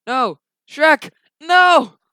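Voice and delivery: high, loud cries of dismay; dismayed shouting. Pretend. Player cries out for lost Shrek, shouting in dismay. Reacts to losing Shrek in game.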